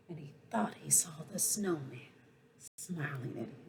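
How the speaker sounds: storyteller whispering at the magic moment; hushed whisper. Acted.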